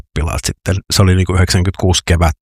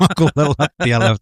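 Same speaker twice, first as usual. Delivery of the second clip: higher-pitched, laughing through the words; 9 semitones higher than usual. Real, not acted. Storyteller recalls trainees dropping to the floor, laughing. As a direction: laugh while repeating the punchline. Laughs at his own army anecdote.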